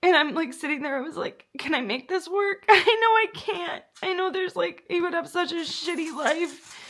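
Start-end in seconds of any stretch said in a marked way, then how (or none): 1.6-6.8 higher, teary laugh; real teary laugh; laughing through tears. Real, not acted. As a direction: crack up and break down at once. Crying laugh over dog.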